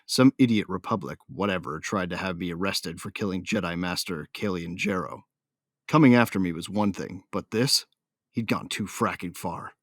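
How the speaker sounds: ordinary delivery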